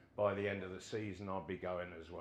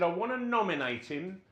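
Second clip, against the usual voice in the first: higher and louder, proud declaration; pitched-up boss voice, declaring; manager's loud announcement; 8 semitones higher, 6 dB louder than usual. Retold or imitated. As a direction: announce it like big news. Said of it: "He said".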